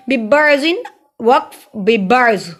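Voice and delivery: slow बिबर्जिन, then बिबर्ज with the ending dropped; pausal ending demo. Shown as a demonstration. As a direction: recite बिबर्जिन, then stop short on बिबर्ज. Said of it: "बर्ज पढ़ा जाता है".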